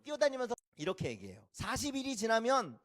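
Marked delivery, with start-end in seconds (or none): 1.5-2.8 high-pitched rote street cry; perfunctory proclamation. Acted. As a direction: call out the warning as a chore. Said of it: "대충 선포하는 거예요". (건성으로 외치는 선포)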